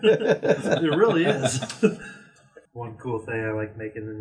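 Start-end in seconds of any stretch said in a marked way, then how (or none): 0.0-2.7 real laughter through the words, louder and higher; laughing. Real, not acted. Agreeing with a friend's joke, laughing. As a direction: agree while cracking up, drawn out.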